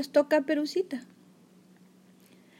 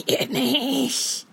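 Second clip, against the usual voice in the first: lightly voiced wolf posing as granny; wolf putting on a granny voice; wolf faking grandma. A narrator's reading. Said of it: "preguntó el lobo fingiendo la voz de la abuela".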